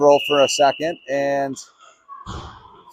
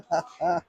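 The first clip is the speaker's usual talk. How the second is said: quiet off-topic chuckle; laughing; 3 semitones higher, 9 dB quieter than usual. Real, not acted. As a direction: chuckle softly to yourself mid-broadcast. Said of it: "Sorry, I'm laughing about something else".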